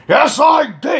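high, indignant mock shout; imitating an outraged customer; big attitude. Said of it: "starts getting this big fucking attitude".

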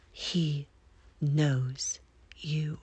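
slow, near-whispered assurance; soft and intimate, word by word; hushed. Real, not acted. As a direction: drop to a hush, spacing the words. Hushed, intimate key point.

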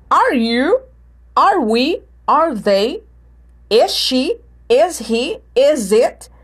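each pair from "Are you" to "Is it" swung up at the end; questioning intonation. Shown as a demonstration. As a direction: model short questions with rising ends. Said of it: "vai ter que mudar a entonação".

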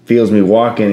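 ordinary delivery

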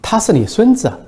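can't believe what was just said; astonished. Acted. Reporter hears the leader called a grandson, astonished. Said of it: "记者惊讶地说".

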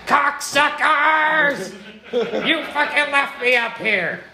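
high, drawn-out hammed-up yell; mock yell from afar. Retold John yelling down the mountain at the ceremony, mock bellow. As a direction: holler it like you're stranded on a mountaintop. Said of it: "you hear him from the top of the mountain yell".

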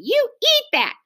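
high, forceful insistence; insistent repeat. A narrator's reading. Hostess thrusts cookie at boy, insistent. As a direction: push the order out high and hard. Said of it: "she repeated".

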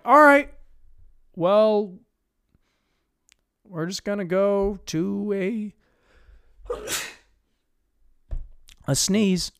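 awkward DJs. Retold or imitated. DJs head to commercial after she hangs up, mock-awkward stalling. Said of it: "the D Js are like".

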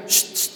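unvoiced shushing; hushing shh. Virgin of Guadalupe shushing the pilgrims quietly. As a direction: shush the crowd softly. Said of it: "la Virgen de Guadalupe les diga".

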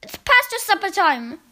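high, loud call upward; grandma calls up to the boy in the tree. Acted. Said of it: "she called out".

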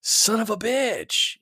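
mock outrage. Realizing Ted Cruz skipped quarantine, high drawn-out curse. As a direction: stretch it up high in put-on disbelief. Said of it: "And I was like".

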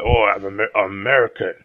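low-pitched drift into American; mock American accent. Friend mocks the actor's creeping L A accent, mock American. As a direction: put on a tough guy's slipping American accent. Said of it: "hard as nails accent. And then it goes to".

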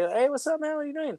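very high, chirpy mock hello; put-on high-pitched friendly greeting; acted perky greeting. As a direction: pipe up like an eager roommate.

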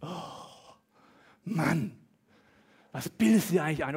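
sighed "Oh", then indignant rebuff; mock exasperation at someone's nerve; exasperated eye-roll. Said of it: "Augentränen zum Beispiel". (genervt, mit Augenrollen)

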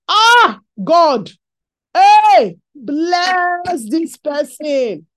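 loud, high and slow pleading cry; 1 fervent mock prayer. People crying out to God for you, loud and high.

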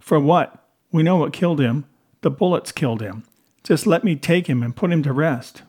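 anguished, loud pleading; upset protest. A narrator's reading. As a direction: protest hard, pushing each line out. Grieving mother confronts detective over body.

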